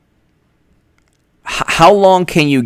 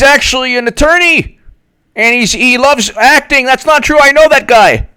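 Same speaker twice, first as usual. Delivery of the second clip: high, mocking know-it-all whine; know-it-all theatergoer; 11 semitones higher than usual. Mocking a theatergoer who heckles the play, high whine. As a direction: pitch it up high to mock a pedant in the audience. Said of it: "and do you say".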